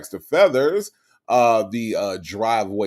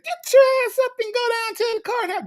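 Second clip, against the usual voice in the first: mock high squeaky bossy voice, much higher and fast; imitating Vila North; faster than usual. Friend Vila telling her to get up for court, high mock-bossy voice. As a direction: play the pushy friend barking orders in falsetto. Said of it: "That's what she said".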